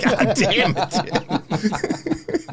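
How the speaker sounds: mock exasperation broken by laughter; a laughing imitation of a fed-up old man; laughing old-man grumble.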